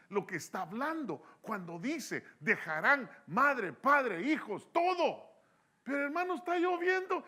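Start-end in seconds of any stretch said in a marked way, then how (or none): 2.4-7.2 louder, slow, pounding each word; forceful preaching. Acted. Pastor proclaiming Jesus' demand to the congregation, forceful and slow.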